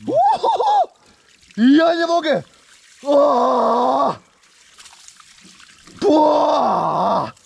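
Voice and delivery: high yelps from icy shock; gasping from cold. Real, not acted. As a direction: cry out as ice water hits. Ice bucket poured on head.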